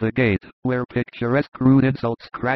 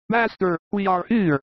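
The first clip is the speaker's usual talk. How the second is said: high-pitched call, no extra volume; lightly acted high shout; mild shout; 8 semitones higher than usual. A narrator's reading. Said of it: "shouts Thomas".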